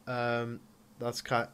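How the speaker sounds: ordinary delivery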